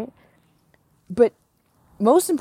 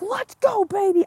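cheering accountant. Retold or imitated. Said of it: "And she's like".

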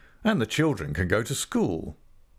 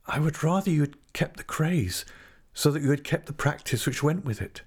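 put-on higher young woman's voice, doubting his promises; Tess, wary young woman; 7 semitones higher than usual. A narrator's reading. Tess doubts d'Urberville's offer of a home, higher, wary. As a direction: Tess pleading her doubts, voice lifted.

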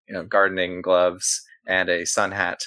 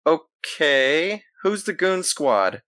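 suspicious florist; 7 semitones higher, much slower than usual. Acted. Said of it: "stops and squints at you and says".

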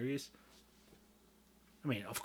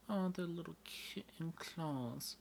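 cooing at kittens; 6 semitones higher, 6 dB quieter, slower than usual. Pretend.

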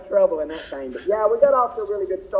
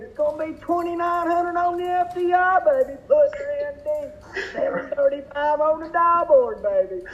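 hype announcer call; 8 semitones higher than usual. Pretend. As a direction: call it like a track announcer hyping the crowd. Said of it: "He wants to hear you put it on there".

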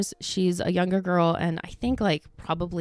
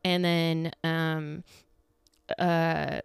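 ordinary delivery

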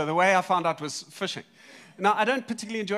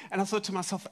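normal talking voice

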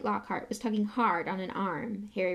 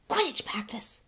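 hushed, breathy whisper; 3 semitones higher than usual. A narrator's reading. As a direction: whisper it urgently. Wood whispering the wake-up.

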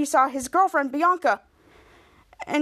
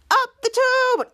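high-pitched catchphrase call; mock heroic cry; 10 semitones higher, 4 dB louder, slower than usual. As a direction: call it out like a superhero launch. Two heroes' catchphrase cry.

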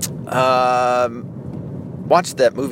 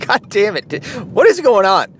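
imitating an exasperated kid, pitched up; mock exasperation; 6 semitones higher, 4 dB louder than usual. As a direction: raise pitch and level on a mock-incredulous outburst. Imitating his bewildered son's reaction.

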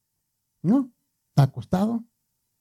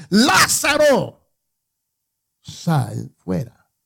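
slow reverent summons, the name stretched high; Jesus calling out; 4 semitones higher, much slower than usual. Acted. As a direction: call the dead man out of the tomb. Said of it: "gritó con fuerte voz y dijo".

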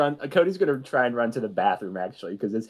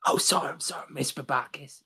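sheepish quiet apology; 2 semitones higher, 5 dB quieter than usual. Acted. Student corrected by principal.

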